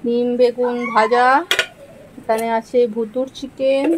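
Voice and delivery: soft, slow show-and-tell of the dishes; menu presentation. Real, not acted. Vlogger presenting her Sunday menu softly to viewers.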